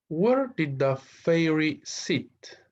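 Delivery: very slow, high question read; slow teacher read-aloud. Shown as a demonstration. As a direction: stretch each word for learners. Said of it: "teacher reading exercise question".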